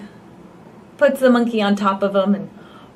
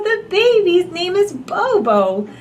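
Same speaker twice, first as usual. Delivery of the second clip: plain reading voice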